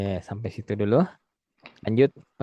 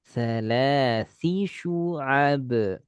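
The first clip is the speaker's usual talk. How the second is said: Salasi syu'ab very slow; slow demo; much slower than usual. Shown as a demonstration. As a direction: spell out Salasi syu'ab slowly. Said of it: "ذي ثلاث شعب".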